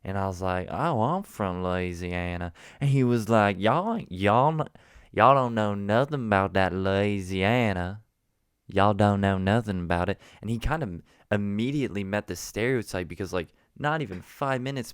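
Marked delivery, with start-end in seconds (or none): 0.7-2.5 slow Southern drawl; put-on Southern accent. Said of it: "So I threw on my Southern accent". 3.6-10.2 loud mock Southern drawl; Southern gentleman. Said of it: "there was this Southern gentleman there".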